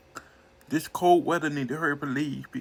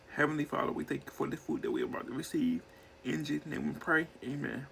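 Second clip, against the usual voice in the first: quick prayer; 5 dB quieter, faster than usual. Real, not acted. Quick prayer before eating.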